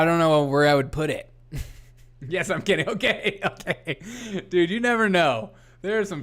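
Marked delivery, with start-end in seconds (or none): none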